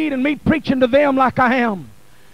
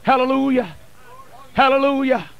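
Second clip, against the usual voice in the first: normal talking voice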